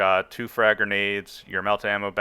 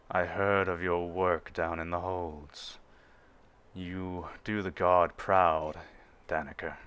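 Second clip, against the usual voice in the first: imposing Imperial officer; 2 semitones lower, 5 dB quieter, slower than usual. Acted. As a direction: speak down from seven feet tall, unhurried. Officer praises Ingrid.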